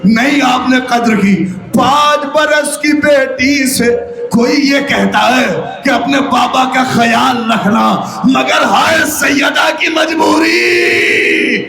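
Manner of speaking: high, ringing lament at full force; impassioned majlis preaching. Acted. Zakir to the mourners, grieving and building. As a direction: preach it out to make the mourners weep.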